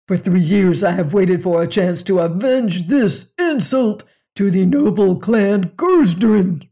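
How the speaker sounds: loud, booming clan pride; proud alien rumble. Acted. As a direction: thunder out the insult to the clan. Alien demanding revenge for clan.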